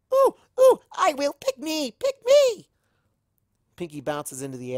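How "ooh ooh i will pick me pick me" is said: very high, loud, bouncing pick me; eager squeal. Acted. Pinkie begs Rarity to pick her, squealing. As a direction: beg to be chosen like a hyper kid.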